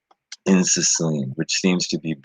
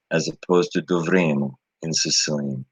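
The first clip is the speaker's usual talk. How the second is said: ordinary talk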